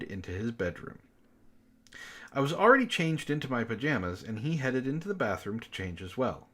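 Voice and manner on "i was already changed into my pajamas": normal talking voice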